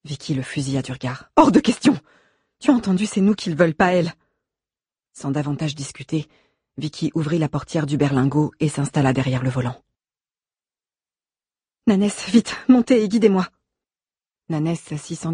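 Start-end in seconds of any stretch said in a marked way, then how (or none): 1.4-4.1 high, loud outburst, then hurried argument; angry refusal. A narrator's reading. Vicky angrily refuses calling police. 11.9-13.5 high, loud hurry-up command; urgent order. A narrator's reading. Vicky hurries Nanès into the car.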